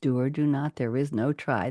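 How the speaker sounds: low, soft, hushed Yoda line; a hint of Yoda, low and quiet; Yoda quote.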